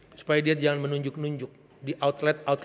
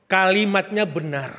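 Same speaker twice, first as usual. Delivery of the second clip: ordinary delivery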